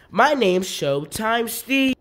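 slow, loud, high showman intro; showman announcer. Acted. Showtime Steve introducing himself, hammy announcer. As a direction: announce yourself like a host.

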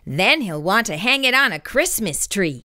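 ordinary delivery, nothing marked

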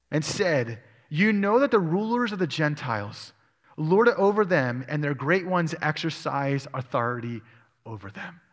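normal talking voice

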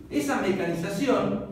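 ordinary delivery

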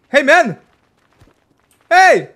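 high, loud hail; calling out. Real, not acted. Gamer to an unhearing English player, calling out. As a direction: hail someone who can't hear.

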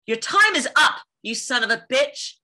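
high, loud punch on the first half; heated heroine's showdown curse; fierce threat. Acted. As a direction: hit TIME IS UP high and hard. Second, stronger take of curse line.

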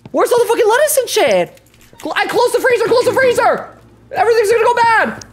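high-pitched, flustered yelling; frazzled cook losing track of everything; frantic kitchen panic. Real, not acted. Frantic search in the game kitchen.

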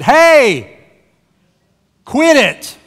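sudden loud, high-pitched imitated shout; fed-up mom yell. Mom to squabbling kids in back seat, fed-up yell. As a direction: explode like a parent at the end of patience. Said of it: "They had finally had enough".

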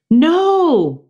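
high, loud No; exclaimed. Acted. Miranda objecting to the teacher, high loud exclaim. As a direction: burst out in protest. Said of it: "Miranda exclaimed".

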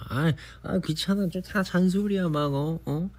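high, whining brush-off; whiny teen self. Retold or imitated. Imitating her younger self brushing off her mother, high and whiny. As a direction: sulk like a teenager dodging nagging. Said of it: "나도 어머니 말을".

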